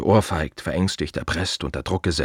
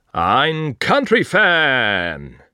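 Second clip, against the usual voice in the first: drawn out, higher and louder, mock astonished; mock amazement; 6 semitones higher, 6 dB louder, much slower than usual. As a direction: stretch it like a fake surprise. Staunend über Helmuts Hobby. (gedehnt und gespielt erstaunt, lauter und höher)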